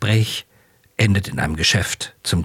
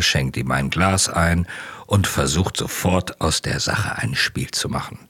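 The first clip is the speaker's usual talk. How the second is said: plain reading voice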